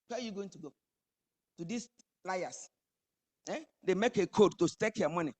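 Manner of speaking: usual speaking voice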